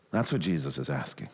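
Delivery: ordinary talk, nothing marked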